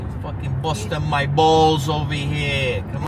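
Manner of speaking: put-on slow New York wiseguy accent. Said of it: "New York accent".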